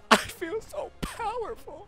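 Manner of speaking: high, slow, hammed-up all-powerful voice; drawn-out high mock gloating; mock grandiose. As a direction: play a silly almighty bird lord. Gliding as a bird, gloating.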